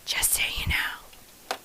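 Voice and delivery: slow hushed whisper; a slow whisper shared like a secret with viewers. Acted. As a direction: lean in and whisper it slowly. Repeated as whisper.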